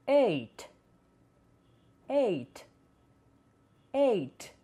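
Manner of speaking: eight said as eit; no gh sound in eight; silent gh. Shown as a demonstration. Teacher models eight with silent gh. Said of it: "consoantes muda no meio".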